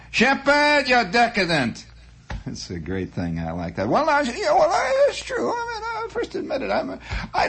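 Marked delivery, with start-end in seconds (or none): 0.1-1.9 much higher put-on Bronx voice calling him decadent; a wiseguy from the Bronx, mock scorn; Bronx accent. Host imagines the listener's voice, hammed-up Bronx accent. Quoting the Bronx letter again. 3.9-7.5 much higher, hammed-up tearful confession; mock sobbing. Host confesses decadence to listeners, mock sobbing.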